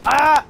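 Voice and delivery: sharp high cry of pain; pained yell. Acted. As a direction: yelp as if shot. Hit by fire in game.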